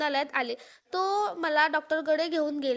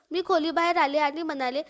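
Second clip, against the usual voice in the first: usual speaking voice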